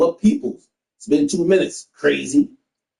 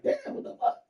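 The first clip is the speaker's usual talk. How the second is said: whispered disbelief; 11 dB quieter than usual. Retold or imitated. Stunned inner reaction to her tears.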